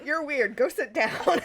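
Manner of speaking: loud dismissive teacher voice; bossy teacher. Pretend.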